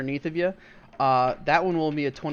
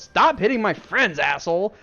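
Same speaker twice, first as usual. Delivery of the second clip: higher, louder snapped threat; angry retort; 5 semitones higher, 5 dB louder than usual. Acted. Highland yelling at the giant, angry and loud. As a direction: tell him off for hurting your friends. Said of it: "and he goes".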